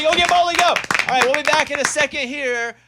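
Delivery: high, loud show outro; radio host sign-off. Acted. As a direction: wrap up the segment with energy.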